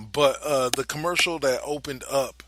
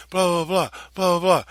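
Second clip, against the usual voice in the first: mocking blah blah; 7 semitones higher, 6 dB louder than usual. Mocking the media noise.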